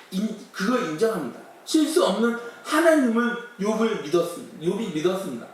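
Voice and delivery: usual speaking voice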